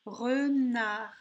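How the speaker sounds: "Renard" slow, both syllables lengthened; drawn-out teaching "Renard"; word sounded out. Shown as a demonstration. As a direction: dictate "Renard" clearly, re then nard. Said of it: "dans la deuxième syllabe".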